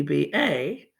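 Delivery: ordinary talk, nothing marked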